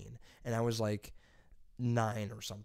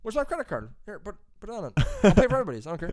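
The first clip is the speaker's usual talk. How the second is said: eager yes; 8 semitones higher, 5 dB louder, faster than usual. Retold or imitated. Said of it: "I'm like".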